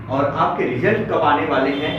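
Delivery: normal talking voice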